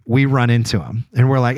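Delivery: usual speaking voice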